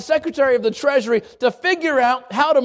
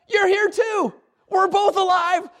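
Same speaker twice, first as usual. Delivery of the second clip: high, thrilled exclaim; excited; 9 semitones higher, slower than usual. Retold or imitated. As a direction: cry out in delighted surprise. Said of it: "they're just so excited".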